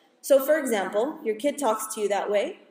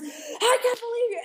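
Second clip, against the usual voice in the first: exasperated parent; 12 semitones higher than usual. Retold or imitated. Parent overreacting to child.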